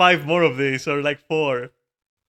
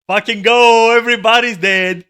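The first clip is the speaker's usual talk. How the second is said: louder, pushing cheer; rally the dead team; 4 semitones higher, 8 dB louder than usual. Real, not acted. Streamer rallies the graveyard people, loud cheer.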